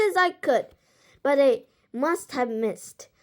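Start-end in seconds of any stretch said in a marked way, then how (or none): none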